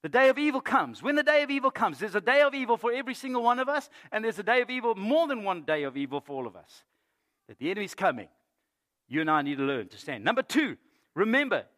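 high, loud, emphatic sermon delivery; full-force preaching. Real, not acted. Preacher urging the congregation to stand against evil, full-force preaching. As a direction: ring it out to the whole congregation.

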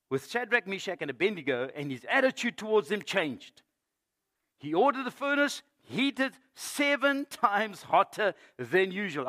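high, loud, dramatic Bible reading; forceful scripture reading. Real, not acted. Preacher telling of the king's furious order, forceful scripture reading. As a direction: proclaim the story to the whole room.

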